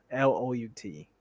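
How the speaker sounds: ordinary delivery, nothing marked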